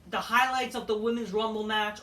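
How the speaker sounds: ordinary talk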